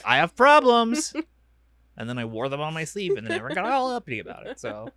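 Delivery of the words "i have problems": high, slow, loud mock proclamation; loud announcement. Retelling warnings that nobody listened to, loudly proclaimed. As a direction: call it out slowly, raised pitch, as a warning. Acts out ignored past warnings.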